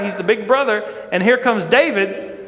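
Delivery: usual speaking voice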